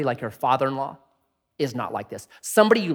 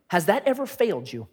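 usual speaking voice

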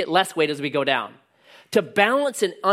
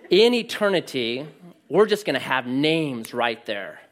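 normal talking voice

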